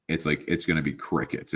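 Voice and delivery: usual speaking voice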